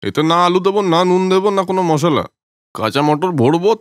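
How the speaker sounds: ordinary delivery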